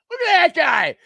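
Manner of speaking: high, loud mock jeer; mocking shout. Co-host imitating everyone ripping the TV guy, mocking shout. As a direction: call it out high and loud, ribbing someone. Co-host mimics pointing at crying fan.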